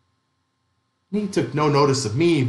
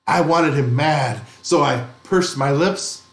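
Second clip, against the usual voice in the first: ordinary delivery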